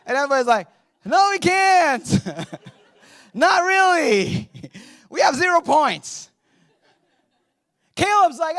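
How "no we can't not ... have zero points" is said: laughing dismissal. Retold or imitated. Crowd laughs him off.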